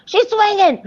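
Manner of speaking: very high, building squeal. Retold or imitated. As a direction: peak of the onlooker's glee. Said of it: "People looking out the window like".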